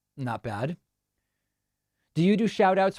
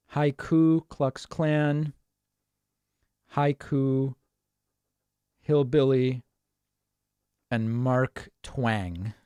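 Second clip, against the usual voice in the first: slow and spelled out; much slower than usual. Shown as a demonstration.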